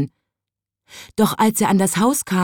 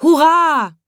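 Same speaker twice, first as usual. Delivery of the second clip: higher, louder hurrah; cheering; 10 semitones higher, 4 dB louder than usual. Acted. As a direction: cheer with joy. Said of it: "riefen die Leute".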